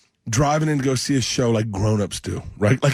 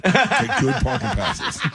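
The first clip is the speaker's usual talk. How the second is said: higher, slow, chuckling through it; laughing; 10 semitones higher, slower than usual. Real, not acted. Urging listeners to get parking passes, laughing. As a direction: laugh as you give the tip.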